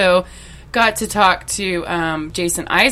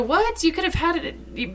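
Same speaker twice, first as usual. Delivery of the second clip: high squeaky "What?" then softer; imitating baffled fans; 8 semitones higher, 5 dB quieter, slower than usual. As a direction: play the incredulous fan. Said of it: "everybody's gonna go".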